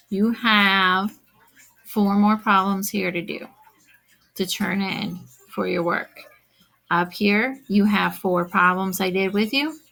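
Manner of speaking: ordinary delivery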